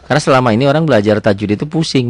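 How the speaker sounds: ordinary talk, nothing marked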